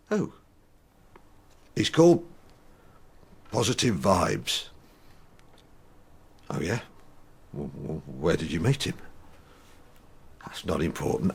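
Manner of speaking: playing himself and his anger; reading his therapy script aloud; two-voice dialogue. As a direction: act both sides of a quarrel. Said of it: "your conversation with your anger".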